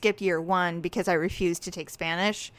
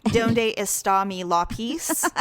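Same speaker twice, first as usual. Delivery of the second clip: put-on slow Spanish read with a flat American accent; flat gringo Spanish; slower than usual. Mocking the son's accentless Spanish, slow and flat. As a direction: say Donde está mi lápiz slowly with no Spanish accent at all. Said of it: "he has zero accent".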